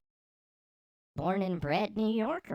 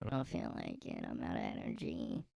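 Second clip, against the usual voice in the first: pitched-down voice; 11 dB quieter than usual. Pretend. Repeating herself to the viewers in a warped low voice. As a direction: voice dropped very low, like a slowed-down recording.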